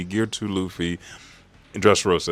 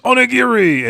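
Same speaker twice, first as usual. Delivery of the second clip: high, loud battle cry; attack shout; 9 dB louder than usual. Retold or imitated. Zoro launches himself towards Emu, shouting his attack. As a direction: yell the move's name.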